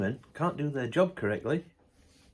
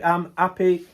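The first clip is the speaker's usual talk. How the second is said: louder, brighter repeat; emphatic happy; 3 semitones higher, 4 dB louder than usual. Real, not acted. Vlogger insisting he is happy, louder. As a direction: say it again, meaning it more.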